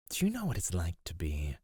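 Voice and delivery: normal talking voice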